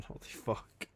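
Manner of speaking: breathless whisper. Real, not acted. Streamer overwhelmed by the gifted subs, whispering. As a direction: barely breathe it out.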